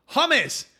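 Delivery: correcting a mishearing, shouted across a field; shouted correction. Retold or imitated. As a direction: call out the word clearly over distance.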